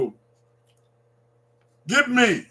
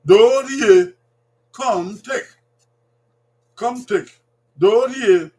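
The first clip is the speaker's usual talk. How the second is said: Dorie called out loud and falling; loud drill; 5 semitones higher, 8 dB louder, much slower than usual. Shown as a demonstration. Teacher calling Dorie out for families to repeat. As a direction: sing out Dorie so they copy it. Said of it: "Say it".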